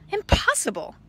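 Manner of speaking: high, loud impossible; shocked exclaim. Acted. The farmer, shocked by typing cows, shouting. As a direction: burst out in disbelief.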